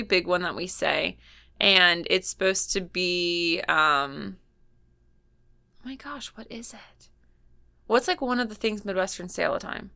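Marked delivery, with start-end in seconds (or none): 5.8-7.1 whispered to herself; whispering. Real, not acted. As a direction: whisper it, searching your memory. Trying to recall, to herself.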